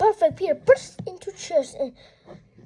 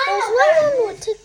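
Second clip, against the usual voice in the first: excited boast; 7 semitones higher, 6 dB louder, much faster than usual. Acted. Character boast.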